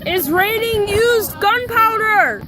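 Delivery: drawn-out, high excited shout; excited yell. Real, not acted. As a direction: call it out with delight. Fireworks debris falling, excited.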